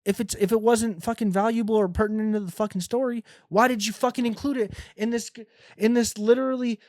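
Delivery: high-pitched exasperated complaint. Acted. Reader complaining to author Tom O'Neill about the book, exasperated. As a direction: demand an answer, voice pushed up high in exasperation.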